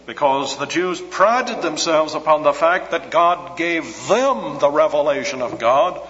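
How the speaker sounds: ordinary talk, nothing marked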